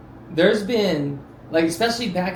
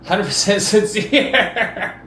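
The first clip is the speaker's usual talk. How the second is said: laughing; 2 semitones higher, 3 dB louder, much slower than usual. Acted. Mocking a fake apology.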